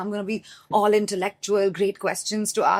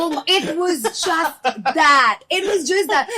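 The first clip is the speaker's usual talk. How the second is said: giddy recap; 8 semitones higher, 5 dB louder, slower than usual. Real, not acted.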